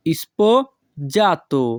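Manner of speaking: sposato broken as "ispo... jato"; slow sposato, pause after "ispo"; syllable by syllable. Shown as a demonstration. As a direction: split sposato in two for learners, "ispo, jato". Teacher repeats word split for learners.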